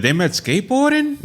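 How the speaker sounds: high-pitched mock-mom voice; imitating his mother. Comedian voices his mother hearing about this, high-pitched mom impression. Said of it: "My mother's gonna watch this and be like".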